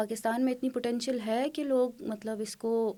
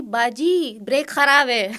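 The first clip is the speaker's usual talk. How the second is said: put-on boy yelling that the brakes are broken; child calling out; 9 dB louder, much slower than usual. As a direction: shout a warning across the path like a kid. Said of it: "اس نے مجھے پیچھے سے آواز دی".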